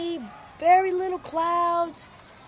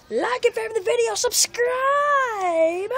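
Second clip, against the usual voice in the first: high, loud, drawn-out shout; excited sign-off; 6 semitones higher, 7 dB louder, slower than usual. Real, not acted. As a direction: belt out a big farewell to subscribers.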